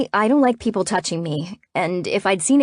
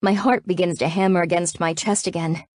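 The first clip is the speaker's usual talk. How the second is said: ordinary delivery, nothing marked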